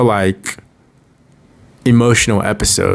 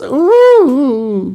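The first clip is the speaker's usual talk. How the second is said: high pained ooh. Real, not acted. Fan reacting to sad scene.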